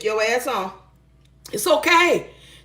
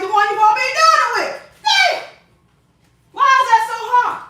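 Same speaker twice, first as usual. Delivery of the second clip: exasperated outburst; 10 semitones higher than usual. Real, not acted. Venting at a bossy partner, a high frustrated outburst. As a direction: throw up your hands and yell it.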